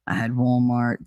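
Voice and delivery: usual speaking voice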